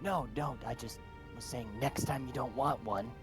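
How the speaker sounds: ordinary talk, nothing marked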